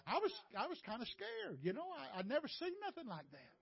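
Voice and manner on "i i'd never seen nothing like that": ordinary delivery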